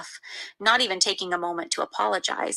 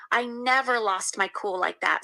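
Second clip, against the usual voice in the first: plain reading voice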